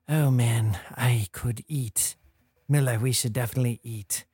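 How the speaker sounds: lightly voiced low murmur; whispering voice in the ear. Hungry voice in the player's ear, low pretend whisper. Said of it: "You hear a whisper in your ear".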